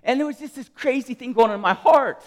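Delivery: high, loud, urgent outpouring; real impassioned yelling. Real, not acted. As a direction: pour it out at full volume. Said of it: "I'm yelling".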